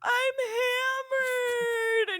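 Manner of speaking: very high, slow, whiny crying voice; mock drunk sobbing. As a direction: wail it like a drunk, heartbroken mess. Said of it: "And I was like".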